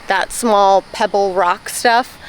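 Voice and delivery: ordinary delivery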